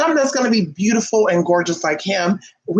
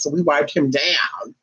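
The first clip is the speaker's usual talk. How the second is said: ordinary talk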